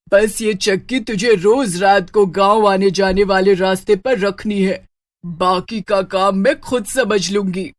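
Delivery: witch voice gloating over an accomplice; pleased witch. Acted. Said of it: "चुड़ैल खुश हो जाती है".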